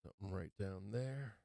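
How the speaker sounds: faint hoarse voice; hoarse, nearly gone voice; losing voice. Real, not acted. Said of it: "I'm losing my voice here".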